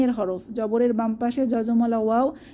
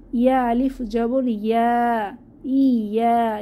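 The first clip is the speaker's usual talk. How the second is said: ordinary talk, nothing marked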